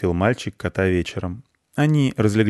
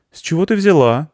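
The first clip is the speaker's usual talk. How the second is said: high, louder startled question; surprised boy; 9 semitones higher, 5 dB louder than usual. A narrator's reading. Boy questions Anya's monster claim, surprised. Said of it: "удивился мальчик".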